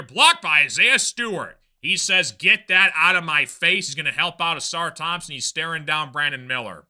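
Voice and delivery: brisk basketball call, rising on the action; live play-by-play. Real, not acted. Broadcaster calling the Pistons game for viewers, brisk play-by-play. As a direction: keep the play moving like a TV caller.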